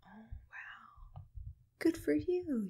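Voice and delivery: ordinary talk, nothing marked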